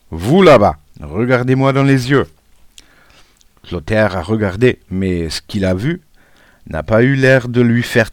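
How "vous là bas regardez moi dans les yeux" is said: loud, stern schoolmaster's order; stern supervisor. A narrator's reading. Supervisor singles out a misbehaving pupil, stern and loud. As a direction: call out the culprit sternly.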